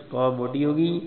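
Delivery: normal talking voice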